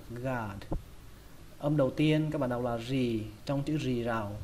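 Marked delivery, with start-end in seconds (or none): 0.1-0.6 "gad" with a long a; drawn-out "gad", falling and soft; lengthened vowel. Shown as a demonstration. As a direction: stretch the a in "gad" slightly. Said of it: "hơi kéo dài nó ra một chút".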